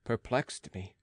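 ordinary delivery, nothing marked

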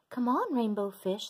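bright high calling voice; calling fish friends. Acted. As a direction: call out cheerfully. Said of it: "they would call".

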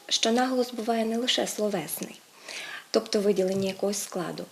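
ordinary talk

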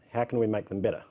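normal talking voice

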